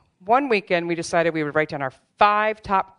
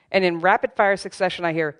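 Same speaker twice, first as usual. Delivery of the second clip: usual speaking voice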